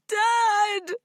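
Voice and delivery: high, loud cry; calling out. A narrator's reading. Distressed girl calling her dad, high and loud. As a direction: call out for dad urgently.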